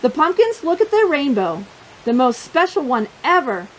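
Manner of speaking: ordinary delivery, nothing marked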